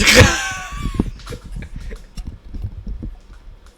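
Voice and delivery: shrill burst of laughter; high squealing laugh. Real, not acted. Big laugh after the skit.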